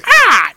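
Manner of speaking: a loud, high parrot screech; parrot squawk. Retold or imitated. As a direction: squawk like a bird. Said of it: "makes parrot noises".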